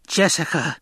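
high sharp cry of a name; screamed name. A narrator's reading. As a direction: call her name in alarm. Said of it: "the old woman screamed".

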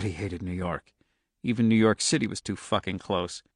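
ordinary delivery

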